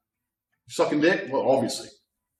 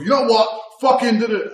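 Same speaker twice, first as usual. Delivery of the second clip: loud, higher snap from calm; sudden shout; 7 semitones higher, 5 dB louder than usual. Retold or imitated. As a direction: flip to a mock yell. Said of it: "they'll go".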